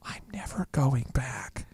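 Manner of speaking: slow, hushed whisper to himself; inner-voice whisper. Retold or imitated. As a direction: whisper it as a private vow, slowly. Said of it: "in my inner dialogue, I was going".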